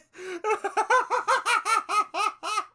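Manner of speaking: high-pitched helpless laughter; can't hold it in. Real, not acted. Cracking up at text.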